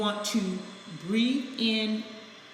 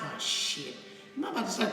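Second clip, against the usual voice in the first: retold quiet dismay; 6 dB quieter, faster than usual. Recalls noticing own tears coming.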